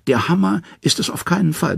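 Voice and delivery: hohe, spitze Loki-Stimme; Loki behauptet. A narrator's reading. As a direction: eifrig widersprechen, hoch angesetzt. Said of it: "behauptet er". (Loki behauptet, deutlich höher gesprochen)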